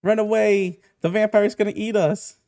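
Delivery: high, loud playground shouts; acted kid yelling. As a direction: yell like children in a mock chase. Children flee, Bonnie yells after them.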